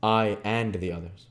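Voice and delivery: plain reading voice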